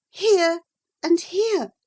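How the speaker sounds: put-on young lady pressing on her heart; Catherine. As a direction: point out each place with feeling. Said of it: "replied Catherine".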